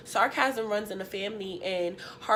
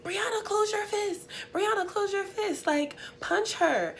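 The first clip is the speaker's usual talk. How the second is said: high, egging on from the side; hyped-up cousin shouting advice; cousin coaching; 10 semitones higher than usual. Retold or imitated. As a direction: urge her to close her fist. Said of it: "she's on the side like".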